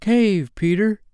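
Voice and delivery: high, loud warning call; little star's urgent cry; tiny star calling out. A narrator's reading. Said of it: "smallest star of all called out".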